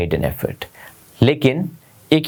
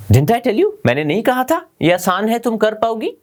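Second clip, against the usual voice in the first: delighted; 10 semitones higher than usual. Acted. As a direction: cheer her success. Teacher delighted at her success. (ऊँची खुशी भरी आवाज़ में)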